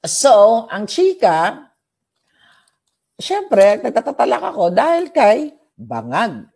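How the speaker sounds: eager chismis delivery; excited gossip. Real, not acted. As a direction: build up the juicy part. Animated gossip build-up.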